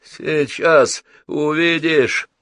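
slow, high, ominous promise; odd, stretched villain's warning; strange tone. A narrator's reading. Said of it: "странным тоном сказал Буги".

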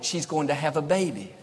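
ordinary delivery

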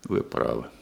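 very low, soft concession; quiet resignation; defeated admission. Acted. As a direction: give in, voice sunk low.

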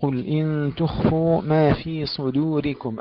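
"صدوركم" heavy saad, light daal; slow tajweed recitation. Shown as a demonstration. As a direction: recite "قل إن تخفوا ما في صدوركم" slowly. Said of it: "تفخيم الصاد".

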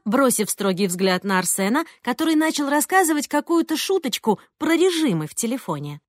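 ordinary delivery

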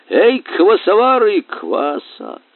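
громкий, высокий, протяжный зов; кликни слуг через весь зал, к концу спадая; королевский оклик. A narrator's reading. (зычно подзывая)

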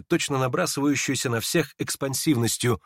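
plain reading voice

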